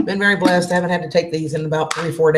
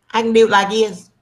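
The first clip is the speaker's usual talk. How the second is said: higher and louder, a put-on tough voice; mock tough voice; 5 semitones higher, 4 dB louder than usual. Shown as a demonstration. Streamer to chat, showing a louder put-on voice. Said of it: "I can do voices".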